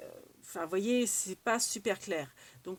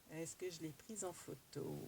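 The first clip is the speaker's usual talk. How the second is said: much softer, lower self-question; muttering to herself; 4 semitones lower, 15 dB quieter than usual. Real, not acted. Vlogger searching her photos, muttering to herself. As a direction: mutter it under your breath.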